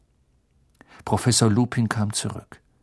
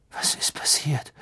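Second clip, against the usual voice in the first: breathless whisper, dazed; 3 semitones higher, 5 dB quieter than usual. A narrator's reading. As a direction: barely voice it, just come to. Harry wakes after fainting.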